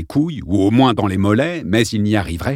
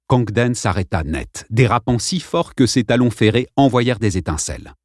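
usual speaking voice

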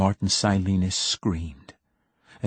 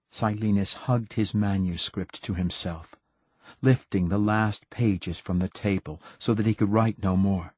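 usual speaking voice

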